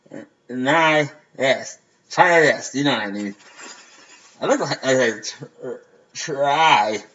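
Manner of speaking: mumbling around a mouth guard. Real, not acted. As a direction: lips stretched, words slurred. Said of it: "I can't even say".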